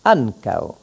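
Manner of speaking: ancao with the stress on an and the ao sliding into one syllable. Shown as a demonstration. Teacher showing where the stress falls. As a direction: lean on an in ancao. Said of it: "le mot se prononce".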